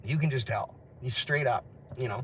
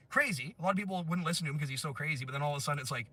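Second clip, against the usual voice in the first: ordinary talk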